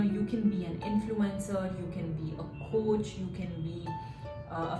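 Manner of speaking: ordinary delivery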